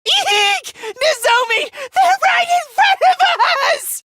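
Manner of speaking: very high, squealing fright; panicked shriek. Acted. Warning Nozomi of danger in front, panicked shriek.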